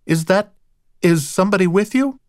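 high, hesitant questioning call. A narrator's reading. Woman in the bedroom asks who is visiting, calling out. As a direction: call out uncertainly from another room. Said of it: "called from the bedroom".

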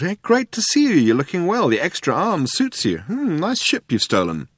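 high, swooping casual drawl; cool drawl. A narrator's reading. Ford trying not to be outcooled by Zaphod, drawling. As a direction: drawl the compliments, too cool to care. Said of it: "he drawled".

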